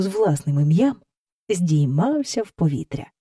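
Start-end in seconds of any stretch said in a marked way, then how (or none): none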